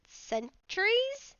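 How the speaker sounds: rising, drawn-out disbelief; slow incredulous question; surprised. Real, not acted. Player reacts to "centuries.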